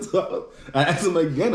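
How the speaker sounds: ordinary talk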